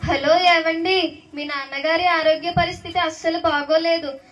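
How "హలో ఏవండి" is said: slow, loud call down the line; loud phone hello. Acted. Caller shouting urgent news to the daughter, loud and slow. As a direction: stretch it out loud over the phone.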